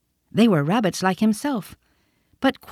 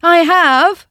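much higher, louder protesting cry; cried out; 10 semitones higher, 9 dB louder than usual. A narrator's reading. Toy rabbit crying out to the wild rabbit, loud and high. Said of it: "cried the little rabbit".

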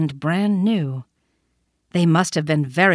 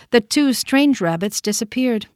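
usual speaking voice

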